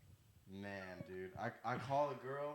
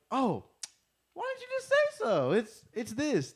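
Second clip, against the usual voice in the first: much higher, louder, sing-song mom; relieved gushing mom; 10 semitones higher, 9 dB louder than usual. Retold or imitated. Mom on the phone to her son's friend, gushing relief. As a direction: gush like a friendly mom, all anger gone.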